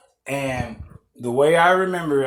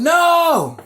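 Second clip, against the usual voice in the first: put-on high, loud little-boy no; toddler's shrill refusal; child yelling no; 14 semitones higher, 6 dB louder than usual. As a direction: whine it like a stubborn toddler.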